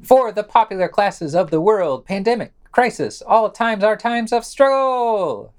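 hammed-up revolutionary orator; mock grand proclamation. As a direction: declaim it like a rally banner, tongue in cheek. Reading a slogan as grand proclamation.

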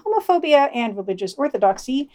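ordinary delivery

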